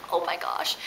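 breathy whispered amazement; whispered oh my gosh. Retold or imitated. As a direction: gasp it out in a whisper. Retelling her reaction to hummus.

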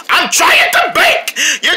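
high, slow, drawn-out yell; exasperated yell. Acted. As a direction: holler it in comic frustration. Cry-a-lot baker yelling at others.

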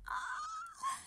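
tiny high-pitched squeal. Real, not acted. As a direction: squeal with delight. Squeal at cute scene.

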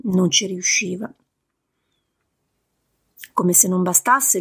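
usual speaking voice

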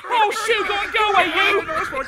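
loud, high shoo-ing shout; shooing crows away. Acted. Farmer Marty shouting at the crows to go away. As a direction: chase the crows off the corn.

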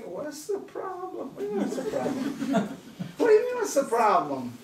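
can't believe the question, half laughing; incredulous. Acted. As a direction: Hagar stunned by the angel's question.

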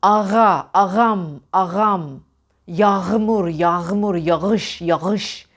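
"Yağmur" with an audible throaty ğ; every soft G in "Yağmur" voiced like French R; sounded soft G. Shown as a demonstration. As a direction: scrape the G in "agam" and "yağış" at the back. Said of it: "yumuşak G'yi çıkarmak istesek şöyle çıkarız".

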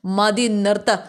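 plain reading voice

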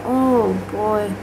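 normal talking voice

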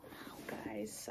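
soft hushed wow; whisper. Real, not acted. Showing off her gift to viewers, whispering. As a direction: breathe it out in a whisper.

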